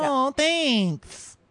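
very high put-on voice; mock gushing thanks. Imitating her reply to his answer, high mock gushing. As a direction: squeal a hammed-up thank-you.

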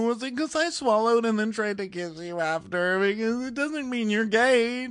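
high, pleading put-on girl voice; mock whiny girl. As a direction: beg like a clingy child. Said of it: "continues mock crying girl".

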